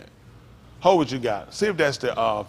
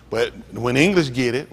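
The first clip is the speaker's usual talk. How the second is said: ordinary talk, nothing marked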